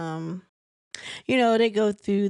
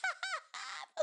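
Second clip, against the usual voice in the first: burst of laughter; 13 dB quieter than usual. Real, not acted. Laughs at friend clocking Trish.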